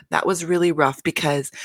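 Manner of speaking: ordinary talk, nothing marked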